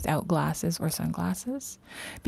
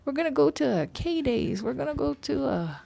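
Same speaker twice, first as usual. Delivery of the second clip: mocking eager friends; 8 semitones higher than usual. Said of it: "They'd be like".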